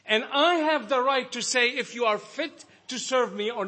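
high, loud, impassioned preaching; full-force preaching. Acted. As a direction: thunder it from the pulpit. Sustained high-pitched loud preaching.

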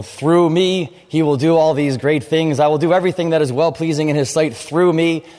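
mock-eager voice of self-made zeal; self-reliant believer. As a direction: play the keen believer bragging "through me". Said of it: "You know".

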